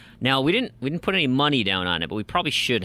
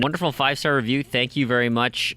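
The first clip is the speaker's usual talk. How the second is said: ordinary talk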